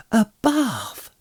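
a high, delighted cry of joy. A narrator's reading. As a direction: Pippin crying out in sheer delight at the bath. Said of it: "cried Pippin".

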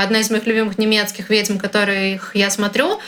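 ordinary talk, nothing marked

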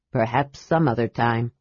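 low, polite dragon voice. A narrator's reading. Dragon politely declines the kender's story, low. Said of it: "said the dragon politely".

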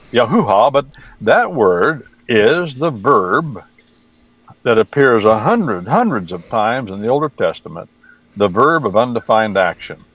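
ordinary delivery

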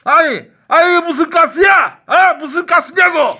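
high, loud exasperated yelling; exasperated shout. Acted. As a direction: yell it in total disbelief. Shouting demand at absurd answer.

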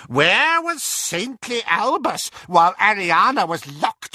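high, forceful gossiping aunt; Auntie Muriel, scornful. A narrator's reading. Auntie Muriel accusing saintly Albus, scornful and high.

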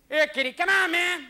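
high, loud tough rat voice; brash New York rat; taunting rat. Acted. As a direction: call the cat out, high and pushy. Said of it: "they sit outside the apartment buildings going".

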